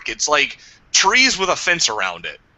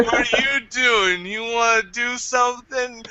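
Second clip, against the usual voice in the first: mock flirty lady; 10 semitones higher than usual. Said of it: "she's like".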